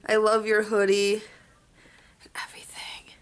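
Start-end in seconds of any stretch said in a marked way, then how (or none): 2.2-3.1 unvoiced whisper, playfully teasing her friend. Real, not acted. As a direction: whisper it behind her back. Teasing friend behind her back.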